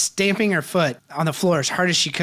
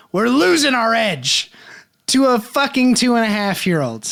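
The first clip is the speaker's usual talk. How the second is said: baseball coach rant; 6 semitones higher, 4 dB louder than usual. Retold or imitated. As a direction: chew them out like a coach after a bad inning. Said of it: "like a baseball coach".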